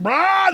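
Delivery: mock retch. Guy vomiting while break dancing.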